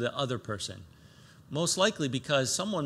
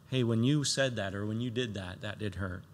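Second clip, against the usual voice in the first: quiet, lower, gentle confrontation; soft rebuke; 5 semitones lower, 5 dB quieter than usual. Pretend. Believer gently rebuking the one who hurt them, quiet. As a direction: tell them softly it hurt.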